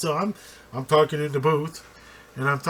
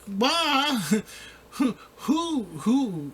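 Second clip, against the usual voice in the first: mock exasperated outburst; 9 semitones higher, much slower than usual. Coordinator scoffing at his coach, mock incredulous. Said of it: "I'd be like".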